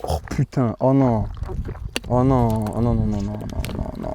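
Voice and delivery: low, quiet dread; fading into a soft moan; dreading groan. Real, not acted. Dreading the chilli challenge.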